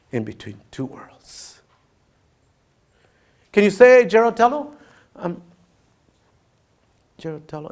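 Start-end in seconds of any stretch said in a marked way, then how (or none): 3.5-5.4 pushy teacher. Retold or imitated. Teacher pushing child to speak. 7.2-7.7 put-on barely audible, a scared child's mumble; small timid voice. Five-year-old in class saying the English name timidly, very soft. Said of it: "Say it big voice".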